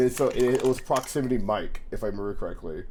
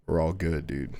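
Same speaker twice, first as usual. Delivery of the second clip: low imitation of the deep-voiced friend; lightly voiced deep reassurance; radio voice; 6 semitones lower than usual. Friend comes back from girlfriend talk, low radio voice. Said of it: "He's got, like, a radio voice".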